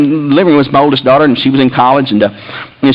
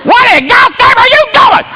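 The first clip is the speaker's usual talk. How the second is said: very high, slow, outraged shriek; furious wife. Retold or imitated. Wife confronting husband over the flood, furious. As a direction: imitate a wife on the attack. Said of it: "it attacked me at the top".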